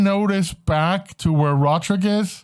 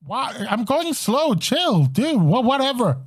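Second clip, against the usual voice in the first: high, defensive dragon voice; a sulky dragon brushing it off; 5 semitones higher than usual. Acted.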